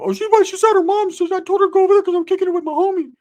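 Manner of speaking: very high, loud squeaky explanation; exaggerated high-pitched imitation; cousin voice. Ricky explains where Sheila is.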